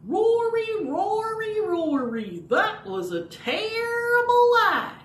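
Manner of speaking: high, loud scolding puppet; Bowser puppet voice. Pretend. Bowser the dog puppet scolds Rory, high and loud. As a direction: call out Rory high and chiding. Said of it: "And then he'll say".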